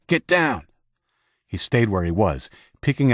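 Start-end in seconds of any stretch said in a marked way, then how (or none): 0.1-0.7 shouted warning under fire; urgent shout. A narrator's reading. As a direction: yell at the men to take cover.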